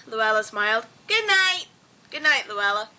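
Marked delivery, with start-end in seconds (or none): 1.1-1.7 high, bright-pitched character farewell. A narrator's reading. Luella saying good night to Norton, cheery and high.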